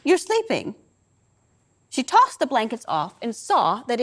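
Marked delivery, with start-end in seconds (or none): 0.0-0.7 high, level mock-flat repeat of the words; deadpan mother echoing her son; matter-of-fact repeat. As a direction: echo his line back, deadpan and higher. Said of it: "repeats my words with the same matter-of-fact intonation".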